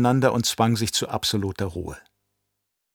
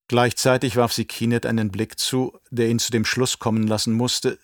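ordinary delivery

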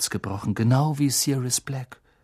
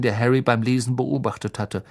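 ordinary talk

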